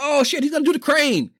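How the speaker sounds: excited. Retold or imitated. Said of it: "It's like".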